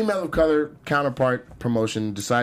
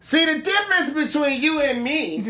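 put-on high, slow, drawn-out sister-girl clapback; exaggerated sister-girl comeback, pitched way up; sassy sister-girl voice; 10 semitones higher than usual. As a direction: play her as a hammed-up ratchet girl.

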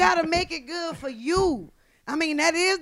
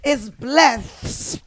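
very slow, drawn-out mock preacher; mock scripture; much slower than usual. Comic blessing friends with her wisdom, slow mock-biblical proclamation. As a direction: stretch each word like a solemn proclamation.

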